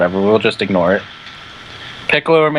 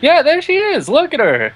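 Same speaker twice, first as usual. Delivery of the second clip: doting coo; 9 semitones higher than usual. Real, not acted. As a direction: coo at her like a proud owner. Cooing over character in shades.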